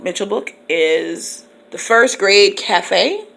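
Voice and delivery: ordinary talk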